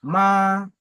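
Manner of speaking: "Ma" held long, high and level; first tone. Shown as a demonstration. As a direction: keep "Ma" high and even, no movement. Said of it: "le premier ton".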